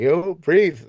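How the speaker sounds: high, loud retold shout; coach calling out. Father coaching his son from the background at a competition, calling out. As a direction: call it out like a ringside coach. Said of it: "you can hear me in the background".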